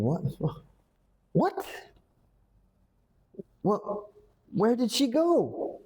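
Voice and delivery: sharp high What, then a high bewildered question; startled, searching, voice leaping up; shocked confusion. Shown as a demonstration. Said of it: "You're thinking".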